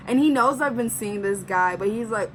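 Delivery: ordinary delivery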